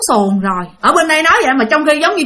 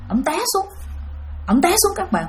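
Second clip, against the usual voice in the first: ordinary talk, nothing marked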